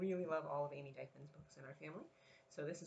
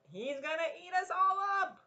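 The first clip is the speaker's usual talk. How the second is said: high, louder bunny warning; worried Dot; 11 semitones higher, 7 dB louder than usual. Acted. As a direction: warn them all, urgently. Parent echoes Dot's line.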